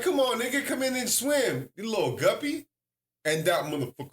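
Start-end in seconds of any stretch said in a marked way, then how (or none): 0.0-2.7 a touch higher, teasing call; playful taunt. Retold or imitated. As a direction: tease him to jump in the pool. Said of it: "I was like".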